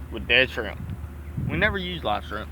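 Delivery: ordinary talk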